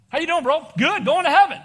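a believer answering a greeting, upbeat and loud; cheerful. Pretend. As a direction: beam with joy. Cheerful exchange between believers.

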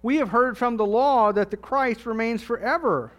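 loud, full-voiced Bible reading; scripture reading. Preacher reads the Gospel passage aloud to the church, proclaiming.

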